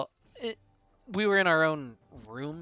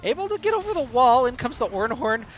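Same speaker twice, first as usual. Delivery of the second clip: upbeat, louder esports cast; play-by-play casting; 3 semitones higher, 7 dB louder than usual. Acted. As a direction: call the fight with rising energy. Excited play-by-play of a fight.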